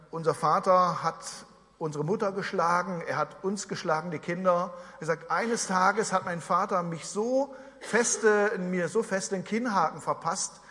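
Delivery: ordinary delivery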